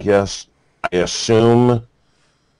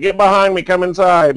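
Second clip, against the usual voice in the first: higher, urgent mock hillbilly orders; hillbilly protector; 9 semitones higher, 4 dB louder than usual. Mountain man suddenly protecting the crew, hammed-up urgency. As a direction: hurry them in like a gruff mountain man. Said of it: "and then, like, instantly".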